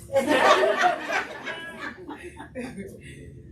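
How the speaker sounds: long, high laugh; real loud laughter, tailing away. Real, not acted. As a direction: burst out laughing and let it run on. Laughs after apology to Crystal.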